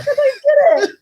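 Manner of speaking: baffled. Retold or imitated. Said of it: "I was like".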